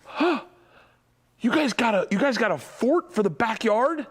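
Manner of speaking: high, exaggerated mock surprise; dad's hammed-up gasp over the fort; fake shock. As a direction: play up the surprise for the kids. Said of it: "to show shock and be like".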